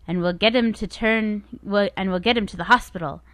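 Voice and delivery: ordinary talk, nothing marked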